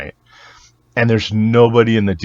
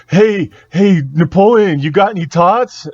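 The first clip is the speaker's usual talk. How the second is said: high, loud imitated call; retold pushy fan calling out; mock heckling fan; 10 semitones higher, 4 dB louder than usual. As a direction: pester the actor with his own movie quote. Fan shouts quote at actor.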